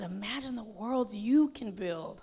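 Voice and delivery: imitating his hushed whisper; mock whisper. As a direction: breathe it like a secret. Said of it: "He said".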